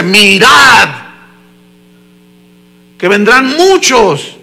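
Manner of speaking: loud, drawn-out preacher's shout; booming scriptural command; shouted warning. Acted. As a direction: cry out the warning to the congregation. Preacher acting out the biblical warning.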